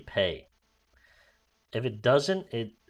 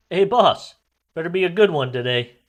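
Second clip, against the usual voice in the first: higher, louder, cocky greeting; big cheerful call; 8 semitones higher, 11 dB louder than usual. Acted. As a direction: call it out across the arena. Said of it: "lightheartedly to the governor".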